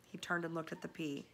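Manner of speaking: plain reading voice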